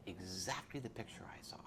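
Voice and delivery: a quick whisper under the story; whispered aside. Real, not acted. Aside in middle of quote.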